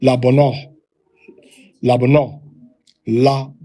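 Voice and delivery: "la bono" with a short quick la. Shown as a demonstration. Teacher demonstrating the Tiberian pronunciation. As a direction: clip the "la" quick before "bono". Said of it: "a quick la".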